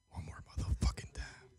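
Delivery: hushed whisper under breath. Real, not acted. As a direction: breathe the curse out unvoiced. Redialing, whispered curse.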